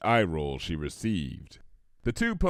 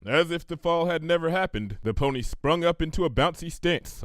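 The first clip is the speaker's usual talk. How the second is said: ordinary delivery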